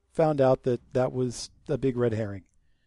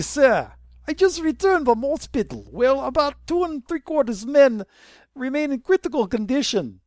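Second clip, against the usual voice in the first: higher, hammed-up Russian officer accent; 8 semitones higher than usual. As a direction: play a Russian cop giving his report. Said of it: "my Russian accent's dying".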